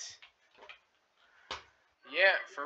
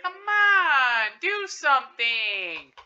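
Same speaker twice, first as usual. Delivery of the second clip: impatient shout; 6 semitones higher, 6 dB louder, much slower than usual. Real, not acted.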